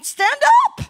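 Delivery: high, loud order to stand; sharp command. Acted. Pastor ordering the depressed to rise, loud and high. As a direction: snap them to their feet. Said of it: "commands the church".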